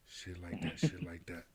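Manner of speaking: hushed whispered repeat; whispering. Retold or imitated. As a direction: whisper it like the song drop. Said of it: "whispering and shit".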